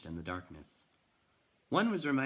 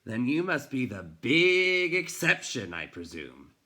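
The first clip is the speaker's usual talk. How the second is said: loud, higher, slow mocking jab; sarcastic sneer; 5 semitones higher, 8 dB louder than usual. A narrator's reading. Bookseller mocks the boy as the exception, sarcastic. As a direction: belittle him with mock politeness.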